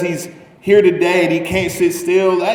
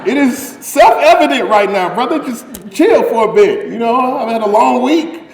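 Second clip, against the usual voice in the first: frustrated; 7 semitones higher, 4 dB louder than usual. Retold or imitated. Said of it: "would frustrate me so much".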